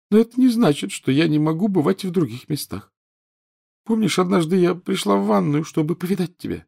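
high, reproachful schoolgirl ghost; whiny ghost girl. A narrator's reading. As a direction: sulk like a neglected ghost girl. Narrator voices Myrtle.

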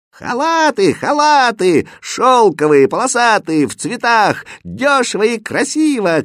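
high, loud hawker's call; ringing singsong sales shout; vendor shouting. A narrator's reading. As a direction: cry your wares across the bazaar. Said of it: "кричал продавец".

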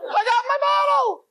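very high-pitched shriek of triumph; ecstatic screaming. Acted. Man celebrating in the bathroom, ecstatic screaming. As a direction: squeal it out, beside yourself with joy. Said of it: "What is he screaming?".

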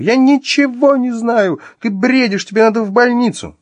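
high, loud frantic screaming; hysterical yelling. Acted. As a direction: yell in utter desperation. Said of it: "истошно заорала Вероника".

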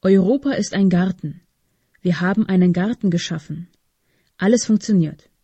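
ordinary delivery

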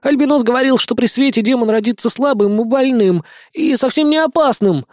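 high, squeaky mouse voice; squeaking. A narrator's reading. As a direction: squeak it out timidly. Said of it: "пискнув".

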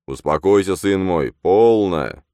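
a commanding priest hushing; stern priest. A narrator's reading. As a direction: settle him down firmly. Priest calms him.

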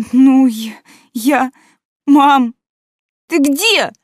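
high, loud panicked cry. Acted. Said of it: "Возглас полной паники".

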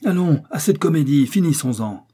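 furious count. Acted. The furious count orders Riri to stop resisting, angry. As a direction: snap at her to end the game. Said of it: "revint maintenant furieux".

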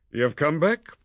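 a touch of mocking cheer; cheerful Chaffrey. A narrator's reading. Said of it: "he said quite cheerfully".

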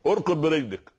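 high, loud, commanding call; "اركض" thrown out high and loud; booming divine command. Acted. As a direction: proclaim it like God ordering him to rise. God's command quoted emphatically.

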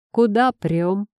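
gruff street thug voice; russian street thug. Acted. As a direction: block their path with a sneer. Thug blocks their way.

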